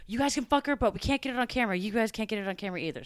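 high, loud, fast laying down the law; mock stern boss. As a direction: snap out the rules like an annoyed boss. Boss Jim laying down rules.

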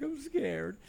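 high mock-scared little voice. Host retells being too shy to touch her, mock scared. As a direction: play it timid and small. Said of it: "I'm like".

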